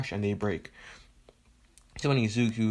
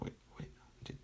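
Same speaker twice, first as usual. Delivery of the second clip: very soft, voiceless disbelief; barely audible, halting acted shock; stunned whisper; 20 dB quieter, much faster than usual. Stunned rival retold after being flipped.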